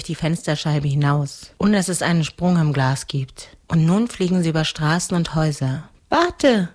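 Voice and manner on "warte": put-on higher girl's call. A narrator's reading. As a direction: call out as a child to halt someone. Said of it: "ruft Miene Mane". (heller Kinderruf)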